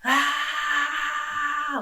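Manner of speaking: mock sustained shriek; imitated scream. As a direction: mimic teenage girls screaming, drawn out on one high note. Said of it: "start screaming at the top of their lungs".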